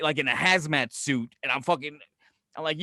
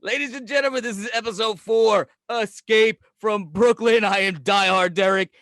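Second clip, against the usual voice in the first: show announcer; 3 semitones higher, 4 dB louder than usual. Acted. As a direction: sign off the episode like a host on stage.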